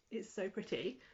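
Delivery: ordinary talk, nothing marked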